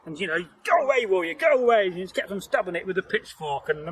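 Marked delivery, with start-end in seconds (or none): 0.6-1.9 high, loud cry to drive a beast off; mock frightened shout. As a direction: shoo the monster away at raised pitch. Acted shout at attacking werewolf.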